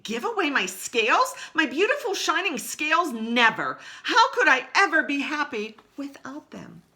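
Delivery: high, loud protest; horrified refusal. Acted. Rainbow Fish refusing to give away his scales. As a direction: clutch at what's yours.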